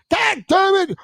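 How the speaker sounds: high, strained cursing boss; mock boss curse. Boss yelling at the crew, high mock curse. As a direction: squeal it out like the angry boss.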